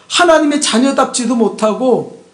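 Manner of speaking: usual speaking voice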